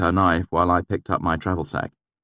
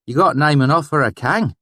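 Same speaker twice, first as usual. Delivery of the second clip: valley dialect; 10 semitones higher, 7 dB louder than usual. A narrator's reading. Narrator faking the swineherd's dialect to gain trust, valley accent. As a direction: slip into the valley dialect to win him over. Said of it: "my sudden change in dialect".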